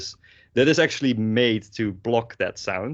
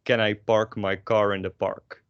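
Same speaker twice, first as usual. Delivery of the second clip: Dutch accent, no aspiration on p and k; unaspirated Dutch-accented English. Shown as a demonstration. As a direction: say it Dutch, with airless "park" and "car". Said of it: "get rid of all your aspiration".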